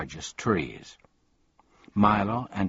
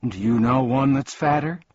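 higher, louder, impatient question; 5 semitones higher, 5 dB louder than usual. A narrator's reading. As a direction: fire the question back impatiently. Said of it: "he asked impatiently".

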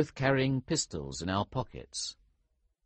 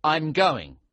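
raised, louder firm announcement; 7 semitones higher, 7 dB louder than usual. A narrator's reading. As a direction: announce it firmly, brooking no argument. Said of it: "I announced firmly".

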